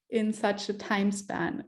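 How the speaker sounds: ordinary delivery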